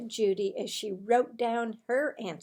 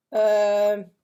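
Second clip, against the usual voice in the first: loud, drawn-out uncomfortable um; awkward hesitation; 12 dB louder than usual. A narrator's reading. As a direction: stall like you don't want to answer.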